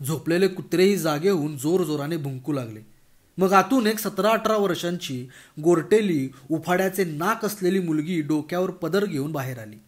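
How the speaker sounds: plain reading voice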